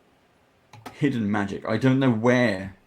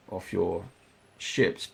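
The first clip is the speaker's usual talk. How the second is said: ordinary talk, nothing marked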